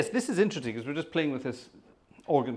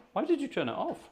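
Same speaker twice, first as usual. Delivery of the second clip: much higher, dismayed question; protest, caught off guard; indignant surprise; 11 semitones higher than usual. Real, not acted. Wife turned the air off.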